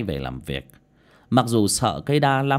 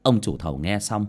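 ordinary delivery

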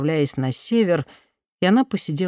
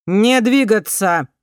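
slow roaring command over a loudspeaker; through a megaphone; 5 semitones higher, 7 dB louder, slower than usual. A narrator's reading. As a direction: bellow it through a bullhorn. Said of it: "взревел голос в мегафон".

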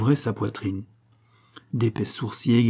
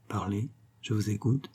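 much softer, held-back reply; subdued murmur; 8 dB quieter than usual. A narrator's reading. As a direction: murmur it, giving in but still trembling. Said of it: "murmura de nouveau". (murmure soumis)

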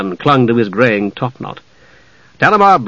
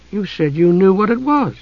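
put-on higher-pitched girl's retort; young Usha, puzzled; 7 semitones higher, 3 dB quieter than usual. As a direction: answer back in a young, pitched-up voice. Usha turns the question back.

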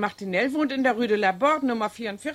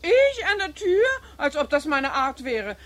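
high, slow, offended disbelief; indignant surprise; 6 semitones higher, 3 dB louder than usual. Acted. Housekeeper feigning outrage at the eavesdropping charge, high and indignant. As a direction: act scandalized at the very idea.

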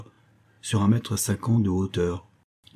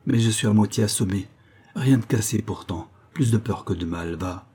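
ordinary delivery, nothing marked

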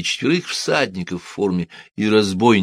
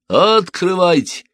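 slow, much higher, louder shout; shouted command; 11 semitones higher, 6 dB louder, slower than usual. A narrator's reading. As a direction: bang on the gate with this command. False gendarmes demand entry.

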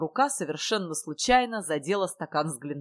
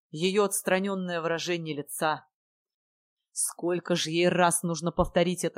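ordinary delivery, nothing marked

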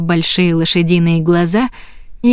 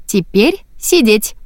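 sweet command; 3 semitones higher, slower than usual. A narrator's reading. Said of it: "милым голоском".

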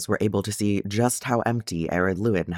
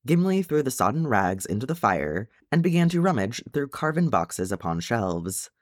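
usual speaking voice

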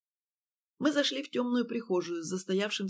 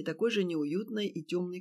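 usual speaking voice

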